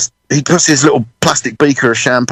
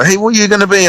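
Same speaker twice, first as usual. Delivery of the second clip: lightly imitated grown-up, higher voice; nosy questioner; 8 semitones higher, faster than usual. Grown-up asking a kid about his future, lightly mimicked. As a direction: quiz a kid in a raised pitch. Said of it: "all you growing up, they go".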